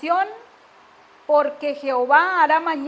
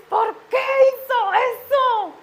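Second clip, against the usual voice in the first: high, drawn-out shout; full-voiced scolding shout; re-enacted mother's yell; 9 semitones higher, 6 dB louder, slower than usual. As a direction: scream it at a child, stretching each word. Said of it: "Cuando yo grité".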